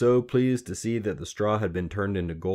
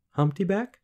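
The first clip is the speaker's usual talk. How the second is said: put-on high, questioning girl's voice; tentative suggestion; 13 semitones higher, 4 dB louder than usual. A narrator's reading. Queen guessing the little man's name, tentative. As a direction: offer it as a guess, pitched right up. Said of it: "she suggested".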